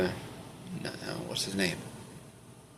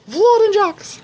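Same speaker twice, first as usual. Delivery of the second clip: very high put-on voice; Leslie; 5 dB louder, slower than usual. Reader trying another take on the impression, high trial voice. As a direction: jump way up high for a silly impression. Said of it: "what my voice sounds like when I pretend to be".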